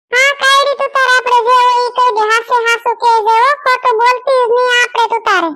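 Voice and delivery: lightly voiced encouraging aunt. Aunt urging Kayadi to speak up, coaxing. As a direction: egg the girl on sweetly.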